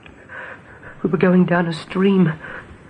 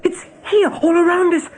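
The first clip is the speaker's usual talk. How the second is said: scared rabbit insisting on danger; tearful fear; 7 semitones higher than usual. Acted. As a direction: plead with a catch in the voice.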